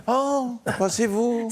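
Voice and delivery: imitating bad acting, overdone "oh"; mock surprise. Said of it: "qu'est-ce que vous jouez mal".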